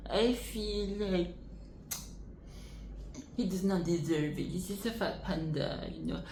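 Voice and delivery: lightly voiced skeptical elder; hint of a grumpy old master; imitating old master. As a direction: grumble like a doubting old teacher. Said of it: "like, elder".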